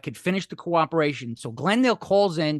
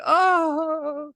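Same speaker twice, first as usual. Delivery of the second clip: high anguished oh; a wailing cry from the grandmother; 11 semitones higher, 3 dB louder than usual. Retold or imitated. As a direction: grandmother cries out at the sentence, high. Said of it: "she's like".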